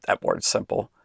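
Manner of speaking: breathy whispered aside. Real, not acted. Whispering an aside to the children.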